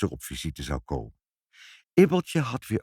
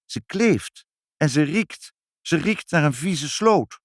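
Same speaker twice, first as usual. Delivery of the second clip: disapproving lady visitor; 7 semitones higher than usual. A narrator's reading. Mayor's wife about the dirty child, disapproving.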